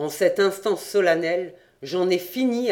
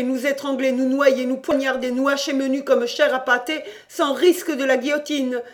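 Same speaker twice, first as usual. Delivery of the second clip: higher, bitter rant of a doomed sailor; frantic cousin Jean; 7 semitones higher, 3 dB louder than usual. Acted. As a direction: spit it out, furious and hopeless. Jean's despairing rant in cabin.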